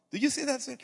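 high-pitched, judging question; condescending tone. Shown as a demonstration. Pastor showing the wrong tone to the waiter, condescending. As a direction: push the question down on him from above. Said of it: "You see the tone?".

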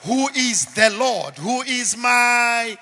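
loud, high, slow climactic sermon voice; booming preacher's climax; full-force preaching. Acted. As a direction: drive the message home to the church.

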